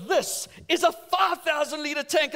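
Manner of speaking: pushy shop assistant; eager salesman. Retold or imitated. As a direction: sell it with gusto. Enthusiastic salesman pitching.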